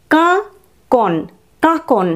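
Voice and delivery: কাকন with nasal first কা, slow and high; কাকন, নাসিক্য কা. Shown as a demonstration. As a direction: model কাকন for pupils. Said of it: "কাকন কথাটির অর্থ".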